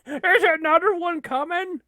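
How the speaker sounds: high, loud laughing squeal of disbelief. Real, not acted. Gamer laughing as another hit comes. Reacting to game onslaught.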